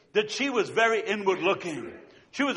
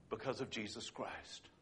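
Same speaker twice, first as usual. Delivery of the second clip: hushed reverent answer; 9 semitones lower, 20 dB quieter than usual. Acted. Preacher hushing the story's climax, reverent soft answer. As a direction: let it land in a hush.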